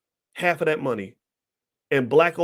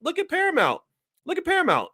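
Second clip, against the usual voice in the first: outraged call-out. Real, not acted. Pointing out Paramount's losses to his viewers, high indignant exclaim.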